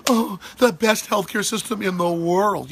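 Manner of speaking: high, slow, gushing mock of the media; mocking media praise. Mocking the media praising Cuban healthcare, gushing and slow. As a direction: parody the fawning media, drawn out. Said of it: "the media's, like, praising".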